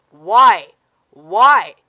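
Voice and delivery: loud, baffled yelling; exasperated shout. Real, not acted. Vlogger yelling at a dumb movie romance, exasperated. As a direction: shout the question twice in disbelief.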